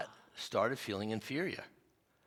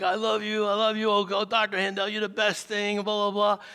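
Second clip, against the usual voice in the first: high, loud gushing thanks; gushing couple; 9 semitones higher, 6 dB louder than usual. Retold or imitated. Reconciled couple thanking their counselor, gushing. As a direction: ham up the overjoyed couple.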